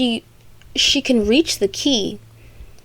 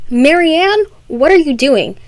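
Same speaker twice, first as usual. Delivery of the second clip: raised, louder call, mock indignant; mock scolding; 6 semitones higher, 5 dB louder than usual. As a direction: call out the name high, then scold. Rabbit scolds Marianne in retelling.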